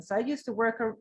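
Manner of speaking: ordinary talk, nothing marked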